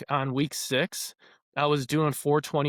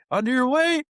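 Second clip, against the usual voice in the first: imitated yell; 10 semitones higher, 4 dB louder than usual. Spotting coach calls lifters back to the bar, imitated yell. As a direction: imitate a coach calling across the gym. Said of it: "he yells".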